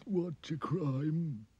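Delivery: high, wailing king sobbing oh dear; crying king. Acted. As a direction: wail like a weepy king. King questions soldier.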